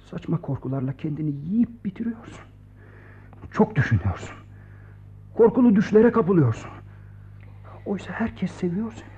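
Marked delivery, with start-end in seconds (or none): 0.1-2.4 hushed, unvoiced gentle reproach; calm him in a whisper; soothing whisper. Friend softly soothing Vasya. 7.9-9.1 hushed, unvoiced gentle reproach; calm him in a whisper; soothing whisper. Friend softly soothing Vasya.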